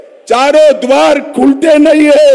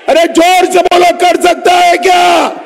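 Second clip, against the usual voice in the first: crowd call; 7 semitones higher than usual. Real, not acted. Urging crowd to shout back.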